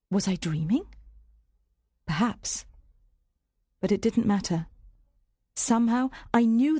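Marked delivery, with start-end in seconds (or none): none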